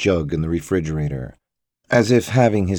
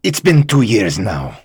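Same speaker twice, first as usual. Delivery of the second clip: louder, impatient shout; 3 semitones higher, 7 dB louder than usual. A narrator's reading. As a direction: lose patience and shout it. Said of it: "Yusuf shouted".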